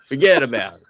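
light New Jersey Italian "forget about it"; hint of a Jersey Italian accent. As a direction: toss it off like a Jersey Italian guy. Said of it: "an Italian on the Jersey coast".

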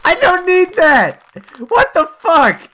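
slow, very high loud outcry; screaming. Real, not acted. Interrupting the reading in disbelief, high screaming. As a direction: scream it out, stretching the words. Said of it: "it's just you're screaming".

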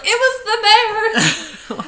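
high, loud mock cry of discovery; dramatic realisation. Telling housemate what caused the food poisoning, dramatic high cry. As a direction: cry it out as the awful truth lands.

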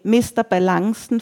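plain reading voice

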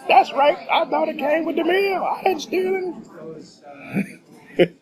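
very high-pitched mock outrage; loud, belligerent mimicry. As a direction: mimic an indignant customer way up high. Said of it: "starts getting loud and belligerent".

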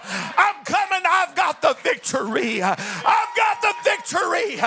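rising to a full preaching shout. Acted.

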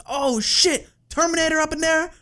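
high, loud rapper impression. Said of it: "probably a horrible T I impersonation".

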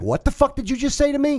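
high, heated confrontation; angry yell. Retold or imitated. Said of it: "I'm starting fucking yelling".

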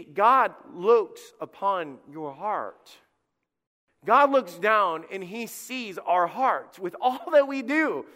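slow preaching. Acted. Pastor pressing the point on the congregation, slow and loud. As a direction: lean on every word like a preacher.

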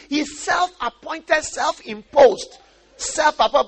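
high, slow, emphatic mock denunciation; acted accusation. Onlooker judging Moses as proud, accusing. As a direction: drag out each accusing label loudly.

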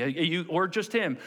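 ordinary talk

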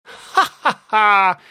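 loud, high villain laugh; drawn-out gloating "ha ha ha"; smug villainous cackle. A narrator's reading. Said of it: "Rumble smirked smugly".

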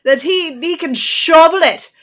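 defiant. Acted. Defiant threat to the teens.

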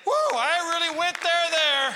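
high, loud self-aware whoop; excited woo. Real, not acted. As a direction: whoop at your own boldness. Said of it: "that might have been a bit harsher".

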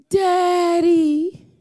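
drawn-out pleading Daddy with a rise; whiny child plea. Retold or imitated. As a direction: beg like a little girl wanting something. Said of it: "That's how they say it".

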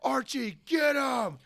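high shouted order to chase; yelling brother. Retold or imitated. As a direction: yell it uphill at your brother, full sprint.

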